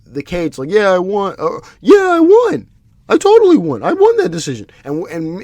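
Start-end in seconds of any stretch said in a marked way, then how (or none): 0.7-4.7 high, loud mocking voice of a boastful fighter; gloating fighter. Mocking a fighter on the cage who lost but claims he won, loud and high. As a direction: ham it up like a fighter crowing he won. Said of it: "he jumps on top of the cage like".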